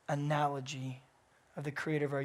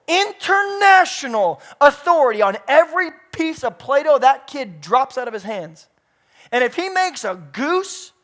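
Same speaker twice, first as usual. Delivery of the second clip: loud, high, driving sermon, each phrase hammered; preaching at full force; 8 semitones higher, 16 dB louder than usual. Acted. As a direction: pastor tells the congregation they were made on purpose, preaching hard.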